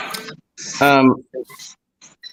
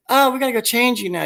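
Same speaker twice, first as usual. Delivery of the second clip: high, quick mom voice; fast, pitched-up mock-mom line; imitated mother; 10 semitones higher, faster than usual. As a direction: mimic a mother hurrying to her baby. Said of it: "the mother is trying to say".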